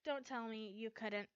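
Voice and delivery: ordinary delivery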